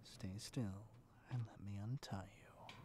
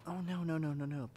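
eager protest; 5 semitones higher, 7 dB louder than usual. Acted. Reassuring apologizing captive.

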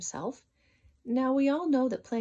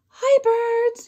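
much higher, much louder call; shout; 14 semitones higher, 12 dB louder than usual. Acted. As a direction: call up to the birds at full voice. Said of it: "he shouted".

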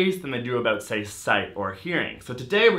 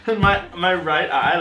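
laughing; 6 semitones higher, 4 dB louder than usual. Real, not acted. Eating sour candy.

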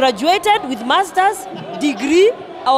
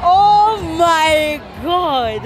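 shocked exclamation; 4 semitones higher, 4 dB louder, much slower than usual. Real, not acted. Shocked by the revealed photo.

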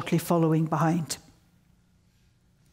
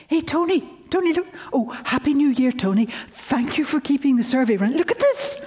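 higher-pitched, eager shout-over to a colleague; excited calling out; 7 semitones higher than usual. Retold or imitated. Student flags down supervisor about her find, excited calling out. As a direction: reenact an excited hail, pitch leaping at the end.

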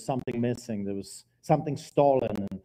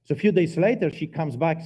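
ordinary talk, nothing marked

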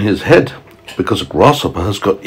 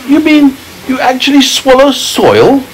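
put-on higher disbelieving boy voice; James amazed. A narrator's reading. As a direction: ask it as an astonished boy. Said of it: "said James".

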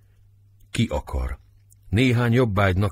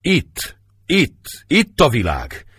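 grasping in grief; 6 semitones higher, slower than usual. Acted. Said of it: "Szemei előtt kapkodván".

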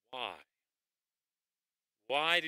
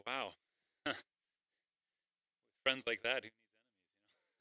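wry joke; 3 semitones lower, 5 dB quieter, faster than usual. Retold or imitated. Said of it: "Now someone says".

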